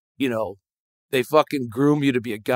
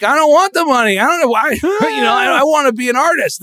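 put-on whiny young self; 12 semitones higher, 4 dB louder than usual. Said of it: "And I was like".

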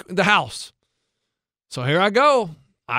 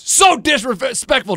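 scolding; 6 semitones higher, 4 dB louder, slower than usual. Retold or imitated. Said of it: "He goes".

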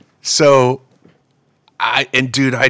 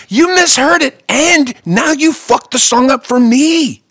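exasperated complaint; 11 semitones higher, 5 dB louder than usual. Retold or imitated. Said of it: "I'm like".

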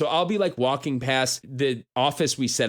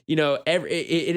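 ordinary talk

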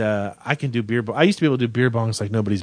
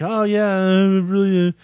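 imitated dazed self; 7 semitones higher than usual. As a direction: play yourself woozy, words dragging. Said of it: "I'm like".

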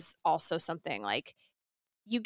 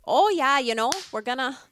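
loud, higher mock-Canadian accent; 5 semitones higher, 5 dB louder than usual. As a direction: put on a playful Canadian accent. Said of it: "that's my Canadian".

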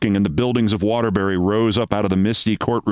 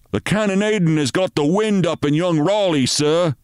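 higher urgent soldier's report; worried sergeant; 8 semitones higher than usual. Acted. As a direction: report it tensely to the captain. Sergeant voiced in story.

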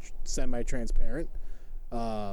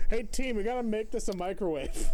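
higher, mocking boss to the team; mock corporate meeting voice; 9 semitones higher, 4 dB quieter than usual. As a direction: play a clueless manager. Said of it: "we're gonna go".

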